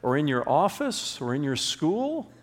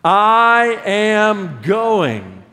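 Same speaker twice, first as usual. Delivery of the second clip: very slow, loud and higher; shouted declaration; 5 semitones higher, 7 dB louder, much slower than usual. Shown as a demonstration. Pastor rallying the church to shout it, slow and loud. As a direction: shout it so the ocean front hears. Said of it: "Say it like you really mean it".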